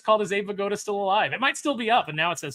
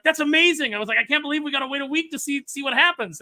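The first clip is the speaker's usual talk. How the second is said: put-on excited kid; 9 semitones higher, 4 dB louder than usual.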